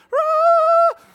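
loud, very high newborn wail; imitated baby scream. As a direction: screech like a crying baby. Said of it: "So he's screaming".

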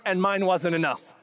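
ordinary talk

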